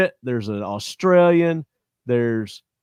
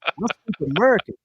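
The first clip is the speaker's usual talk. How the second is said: mock American brag; 6 semitones higher, faster than usual.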